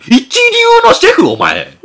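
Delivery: loud incredulous cry breaking into a laugh; can't believe what he just heard; laughing disbelief. Retold or imitated. The father learns the man was a top chef, laughing in disbelief.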